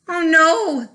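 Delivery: loud, alarmed "Oh no"; dismayed cry. A narrator's reading. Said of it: "the villagers all cry".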